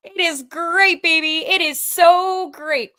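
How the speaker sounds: high, loud gleeful cheer; real delighted shout; elated. Real, not acted. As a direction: crow over the win. Gleeful after the win.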